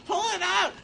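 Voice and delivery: high, loud, repeated yell; urgent shouting. Real, not acted. Player struggling with the helicopter, urgent high shout. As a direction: yell orders in a mock panic. Urgent calls during the game.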